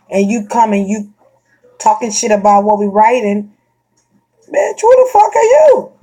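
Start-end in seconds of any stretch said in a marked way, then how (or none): 4.5-5.9 high, loud indignant snap; shrill outraged retort; mock outrage. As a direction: fire back at a critic. Imagined retort to a critic.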